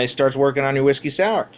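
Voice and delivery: ordinary talk, nothing marked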